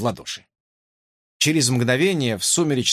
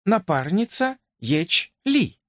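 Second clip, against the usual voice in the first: formal, slow princess voice; 7 semitones higher, slower than usual. A narrator's reading.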